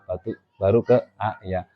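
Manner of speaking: ordinary talk, nothing marked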